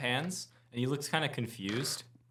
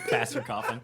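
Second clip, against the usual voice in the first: ordinary talk, nothing marked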